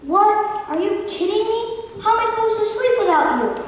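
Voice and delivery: high, indignant disbelief; shocked outrage. Acted. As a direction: squawk it in disbelief. Bear told he can't come.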